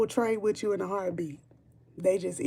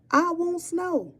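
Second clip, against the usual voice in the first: whiny plea for snow; 9 semitones higher, slower than usual. Real, not acted. Pleading repeatedly for snow.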